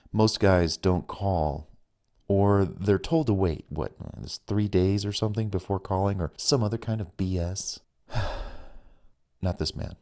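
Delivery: usual speaking voice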